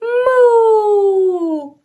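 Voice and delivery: mock cow moo for a toddler. Shown as a demonstration. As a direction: show the child how a cow moos. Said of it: "Can you moo like that cow?".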